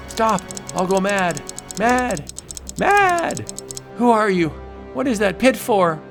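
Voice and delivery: high hysterical cries; hysterical screaming. Acted. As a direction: break into madness. Driven mad by clocks.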